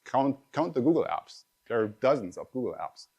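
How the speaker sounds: ordinary delivery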